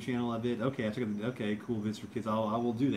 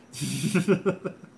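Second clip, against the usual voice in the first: laugh; 7 semitones higher, 5 dB louder than usual. Real, not acted. Laughs after his bad impression.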